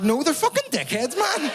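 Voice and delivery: high-pitched indignant outburst; heated protest. Retold or imitated. Son arguing back at his mum about the English, indignant. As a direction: argue back, voice shooting up.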